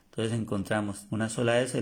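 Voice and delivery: ordinary talk, nothing marked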